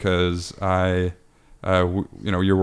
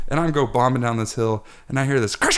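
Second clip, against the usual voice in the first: ordinary delivery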